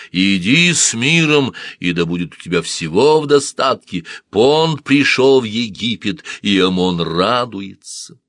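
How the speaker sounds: slow, loud, high oracle voice; prophetic trance voice. Acted. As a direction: intone it like a temple oracle. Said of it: "голос оракула".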